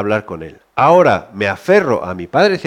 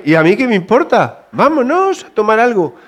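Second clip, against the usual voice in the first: cheery dismissal; 9 semitones higher, 3 dB louder than usual. Retold or imitated. Father brushing off failed exam.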